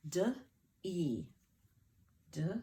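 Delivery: D-E as two separate sounds, duh, ee; isolated phonics sounds for D-E; sounding out. Shown as a demonstration. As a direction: sound out D-E slowly, duh then ee. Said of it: "All right, first syllable".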